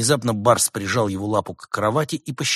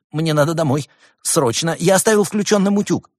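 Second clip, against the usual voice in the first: higher, louder panicky excuse; frightened squeak; 9 semitones higher, 7 dB louder than usual. A narrator's reading. Scared gopher inventing an excuse, high squeak. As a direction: squeak it out in a hurry. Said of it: "сглотнул и пискнул".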